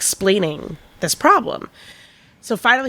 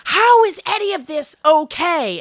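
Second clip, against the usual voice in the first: mock indignant customer; 10 semitones higher than usual.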